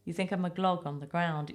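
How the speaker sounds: normal talking voice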